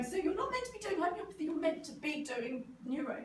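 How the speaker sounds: high, loud imitation of pushy people; retold insistence, starting high and easing down; insistent clients. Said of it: "People going".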